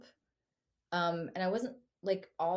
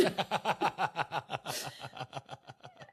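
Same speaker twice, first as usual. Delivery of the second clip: a real, easy laugh at the memory; warm laugh. Real, not acted.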